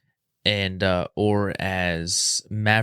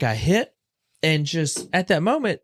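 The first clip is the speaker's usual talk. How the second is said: ordinary delivery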